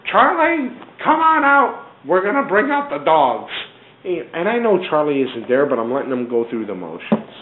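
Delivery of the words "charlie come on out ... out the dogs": high, booming mock police shout; calling out loud. Cops calling Charlie out of hiding, loud mock shout. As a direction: holler it like cops flushing out a suspect. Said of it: "out loud".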